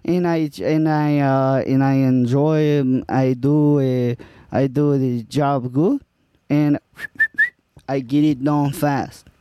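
lightly put-on Spanish accent. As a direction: play Juan, an immigrant worker with a light Spanish accent. Improv scene as Juan.

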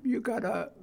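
ordinary delivery

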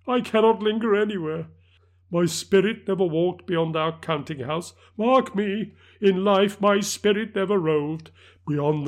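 a tormented spirit lamenting; mournful ghost. Acted. Marley's ghost speech.

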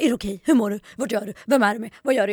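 mock anxious father checking in; imitating worried dad. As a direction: rattle off a worried parent's check-in calls. Dad calling checking on her.

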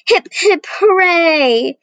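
high, loud cheer of the knights; cheering shout. A narrator's reading. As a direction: shout it like a crowd of knights celebrating. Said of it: "shouted the knights".

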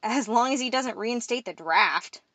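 ordinary delivery, nothing marked

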